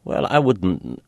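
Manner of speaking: usual speaking voice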